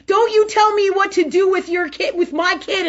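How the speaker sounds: loud, high, angry retort; furious high yell; yelling back. Retold or imitated. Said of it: "She yells at me".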